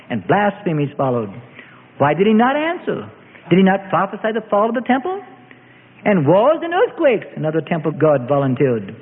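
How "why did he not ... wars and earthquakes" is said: raised-pitch mock questioning. A narrator's reading. Mob ridiculing the prisoner's prophecies, mocking. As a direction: jeer with pretended puzzlement. Mob mocks the beaten Jesus.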